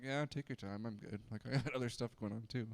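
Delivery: a hint of a shrugging, distracted deity; casual indifferent God. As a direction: toss it off like someone too busy to care. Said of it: "He's just kinda like".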